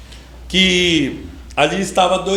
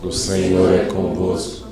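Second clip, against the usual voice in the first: slow, measured Hail Mary recitation; communal prayer recitation; 10 semitones lower, slower than usual. Real, not acted. Leading the congregation in the Hail Mary, slow prayer recitation. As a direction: recite slowly and lower, as in group prayer.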